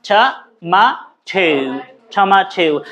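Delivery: "tomato" broken into to, maa, theu, then joined; British "tomato". Shown as a demonstration. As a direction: spell out British "tomato" syllable by syllable, very slowly. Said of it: "ও থাকা সত্ত্বেও ঠৌ বলছে". (ধীরে British "tomato": ট, মা, ঠৌ)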